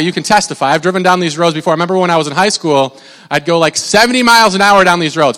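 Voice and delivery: usual speaking voice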